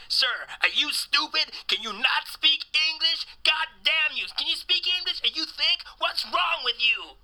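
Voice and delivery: high, loud berating rant; mock outraged American yelling at a foreigner; exasperated caller. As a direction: pile on the questions in a high, incredulous shout. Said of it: "They keep telling me".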